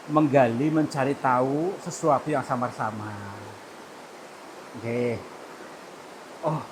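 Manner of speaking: low, soft, humble pleading to the elder; respectful address. Real, not acted. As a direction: speak humbly to calm the spirit.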